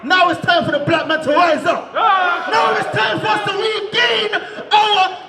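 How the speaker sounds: slow, shouted, rising sermon cadence; preaching at full force. Acted. Street preacher shouting to the crowd, full-force preaching. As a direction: hammer each claim home like a street preacher.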